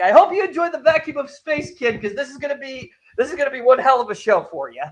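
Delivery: retold villainous farewell, starting high and loud; mock menacing send-off. April taunts the kid before the airlock opens, mock menace.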